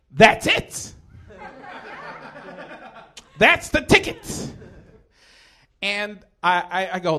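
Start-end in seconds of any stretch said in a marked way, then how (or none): 0.1-4.5 loud, rising comic realisation; sudden eureka. Retold or imitated. Comic acts out finding his escape plan, loud eureka. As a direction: cry it out higher and higher, drawing out the last phrase.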